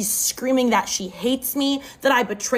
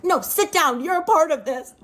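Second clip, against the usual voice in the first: higher-pitched retold command; bossy order; 5 semitones higher than usual. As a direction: act out an agitated order to stay put. Roommate ordering her to stay.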